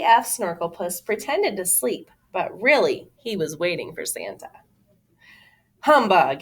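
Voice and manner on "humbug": ordinary delivery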